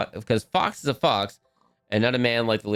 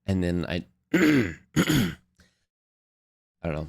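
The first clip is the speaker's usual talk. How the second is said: usual speaking voice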